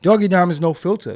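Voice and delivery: loud, punchy name intro; hype intro shout. Acted. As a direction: announce it big.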